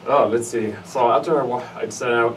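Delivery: ordinary talk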